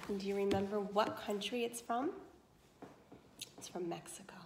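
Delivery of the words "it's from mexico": whispered answer, almost secret. Real, not acted. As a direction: whisper it like a hint to the class. Teacher answers own quiz question.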